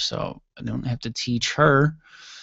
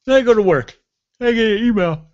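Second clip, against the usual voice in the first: yawning; 10 semitones higher, 7 dB louder than usual. Real, not acted. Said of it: "Oh, I really apologize".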